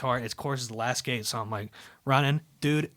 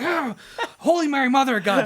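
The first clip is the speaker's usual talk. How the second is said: high, loud panicked outburst; freaking out; 12 semitones higher, 8 dB louder than usual. Retold or imitated. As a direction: yelp it high and loud like you just ran over a cone. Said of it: "I was, like, freaking out".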